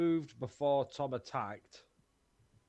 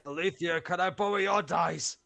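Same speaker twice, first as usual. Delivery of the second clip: raised voice, a little higher; calling out; 4 semitones higher, 5 dB louder than usual. Real, not acted. Player calling to Alethea for dice, raised voice. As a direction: call across for a favour.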